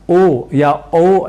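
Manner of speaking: ओ drawn out slowly as a plain o sound; ओ said slowly, in a voice unlike the rest; wrong pronunciation. Shown as a demonstration. Teacher showing students the mistaken ओ, slowly. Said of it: "न कहकर औ ऐसे ही कहना है".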